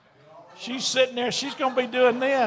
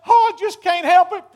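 mock wail, much higher and louder; hammed-up crying wife; 7 semitones higher, 9 dB louder than usual. Wife in the whirling bed overjoyed at a night out, mock crying, high and loud. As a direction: play the wife's fake sobbing punchline big. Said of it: "she's crying uncontrollably".

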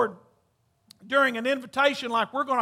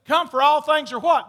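call and response; 3 semitones higher, 6 dB louder than usual. Acted.

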